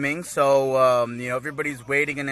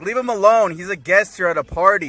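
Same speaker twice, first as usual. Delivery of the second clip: raised, higher-pitched telling-off; mock scolding; 5 semitones higher, 5 dB louder than usual. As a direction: lightly act out defending a guest. Voicing Bulma defending a guest.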